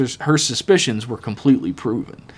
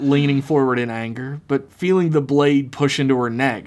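ordinary delivery

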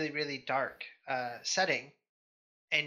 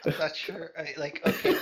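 normal talking voice

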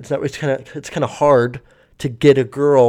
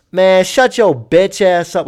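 a slow, louder snap to shut her up; sudden angry outburst; 4 semitones higher, 5 dB louder, slower than usual. Retold or imitated. Passenger lashing out at the stewardess, slow loud outburst. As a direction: lash out, slow and loud, out of nowhere. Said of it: "out of nowhere, just open".

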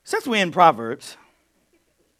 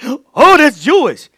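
mock surprise; 8 semitones higher, 11 dB louder, slower than usual.

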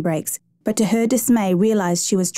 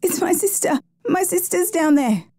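high-pitched, panicked narrated voice; high, distraught character voice; distressed stammer; 9 semitones higher than usual. A narrator's reading. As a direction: act out a frightened sister, voice pitched right up. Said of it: "Taniela stammered".

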